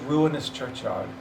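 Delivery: ordinary delivery, nothing marked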